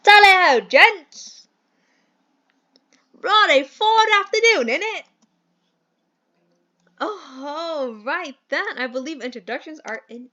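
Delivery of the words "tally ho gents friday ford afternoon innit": high, loud hammed-up British gent; terrible British accent. Mentor hails the lads, hammed-up British accent. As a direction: burst in with an over-the-top British greeting. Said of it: "do the worst British accent".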